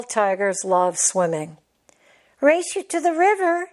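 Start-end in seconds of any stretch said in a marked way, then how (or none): 2.4-3.7 high, excited cub call; a cub dashing ahead to win; shouted challenge. Acted. Tara challenging Tejas, high and excited. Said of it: "shouted Tara".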